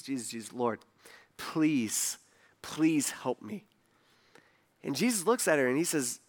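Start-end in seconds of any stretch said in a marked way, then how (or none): 0.0-3.7 hushed, slowing plea on your knees; imitated pleading. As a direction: beg softly, lower and quieter. Kneeling mother begs Jesus for help.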